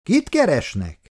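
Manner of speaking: mild call, pitched well up. A narrator's reading. Old man asks strangers from the window, mild shout down. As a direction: call a question down from above, lightly. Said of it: "a férfi lekiabált az ablakból".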